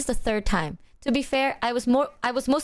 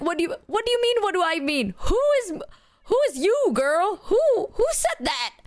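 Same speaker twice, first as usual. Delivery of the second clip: very high, incredulous squawk; mock bewilderment; 9 semitones higher than usual. Waking up to a strange accusation, hammed-up bewilderment.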